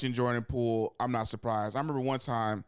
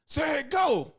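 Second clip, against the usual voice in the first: high, sharp start call; 4 dB louder than usual. Acted. Impatient teammate calling for the next run, hollered. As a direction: holler it across the practice field.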